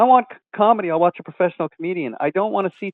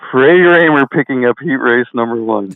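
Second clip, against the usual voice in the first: loud, low track announcer call; Wayne Harper impression; 5 semitones lower, 9 dB louder than usual. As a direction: boom it out like a dirt-track announcer. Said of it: "he'll go".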